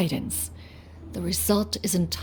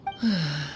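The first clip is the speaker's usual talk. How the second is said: sigh; 4 semitones lower, 4 dB quieter than usual. Acted. Elder before saying time is short.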